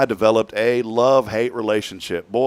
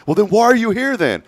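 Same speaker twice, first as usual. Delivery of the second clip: high, louder challenge; mock exasperated retort; 11 semitones higher, 5 dB louder than usual. Client challenging the man who says he's sick of her, mock exasperated. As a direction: imitate an exasperated client firing back. Acted quarrel with lawyer client.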